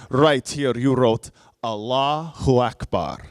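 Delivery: slow, imitated guard with a Russian-like accent; Russian-sounding accent. Guard shows traveler his notebook, slow mock Russian accent. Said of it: "my accent is gonna sound Russian".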